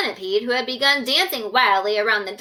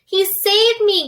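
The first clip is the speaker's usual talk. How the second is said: high, loud, slow breathless gasp; 9 semitones higher, 5 dB louder, slower than usual. Acted. Centipede rescued from the sea, gasping high and loud. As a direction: gasp it out half-drowned. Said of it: "gasped the centipede".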